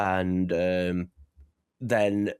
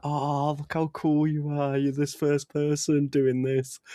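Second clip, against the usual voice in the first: mock gushing praise; 5 semitones higher than usual. Mocking anime characters' praise.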